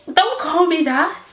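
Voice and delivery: high, firm mock protest; firm objection. Telling the friend not to use the word, high and firm. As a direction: push back at the friend. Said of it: "I used to be like".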